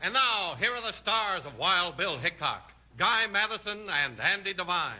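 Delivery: usual speaking voice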